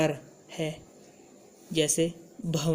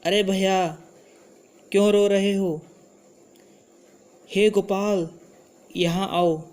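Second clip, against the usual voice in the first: slightly raised voice of address; calling out; 2 semitones higher, 4 dB louder than usual. Shown as a demonstration. Teacher shows changed sound for addressing someone, calling out. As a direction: call out as if summoning someone. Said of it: "वैसे ही sound हमने बदलनी है".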